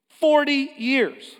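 forty years drawn out high and loud; stretched out for emphasis; slow emphatic. Acted. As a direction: lean hard on each word, slowly. Stressing how long he lived there.

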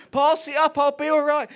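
high, loud forced reassurance; frightened call. Retold or imitated. Lying flat on the scaffold calling to his wife, loud and high. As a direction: shout that you're fine when you're not.

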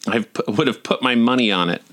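ordinary delivery

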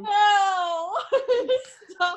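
high-pitched, helpless giggling; cracking up mid-word; laughing protest. Real, not acted. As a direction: protest through real laughter. Cracking up at friends' R joke.

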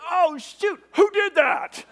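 high, loud comic panic; hammed-up startled shout; alarmed outburst. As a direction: yelp as you notice the mishap. Panic at the drifting boat.

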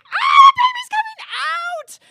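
slow, very high loud wail; birthing scream. Retold or imitated. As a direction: scream it out as you push.

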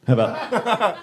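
usual speaking voice

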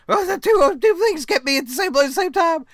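high, fast mocking voice. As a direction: rattle it off in a silly high voice. Mocking the movie's logic.

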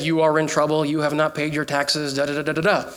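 low monotone robocall imitation; automated message. Preacher retells the scam robocall, automated message voice. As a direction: drone it evenly like a recording. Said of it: "listened to the whole automated message".

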